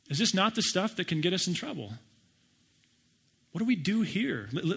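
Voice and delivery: ordinary talk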